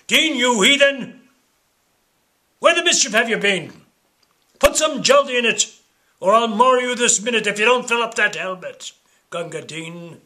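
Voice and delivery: high, loud scolding bark; furious barrack-room telling-off; angry soldier. Acted. Soldiers scolding the water-carrier.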